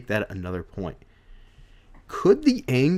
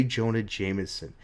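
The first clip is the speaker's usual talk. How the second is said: usual speaking voice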